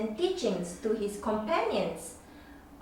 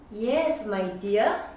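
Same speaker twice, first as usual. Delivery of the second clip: plain reading voice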